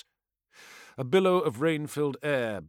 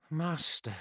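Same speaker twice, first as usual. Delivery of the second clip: weary; 3 semitones higher, 8 dB quieter than usual. Acted.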